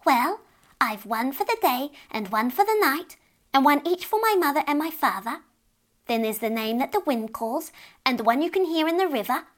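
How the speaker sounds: lightly voiced fairy child singsong; sing-song list. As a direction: reel off names in a sing-song. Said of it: "stopped her sing-song list".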